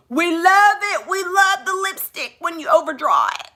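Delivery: high, hammed-up fan voice gushing; mock gushing fan. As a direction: parody an adoring commenter. Mocking fans' gushing.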